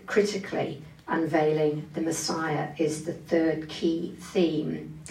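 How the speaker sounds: normal talking voice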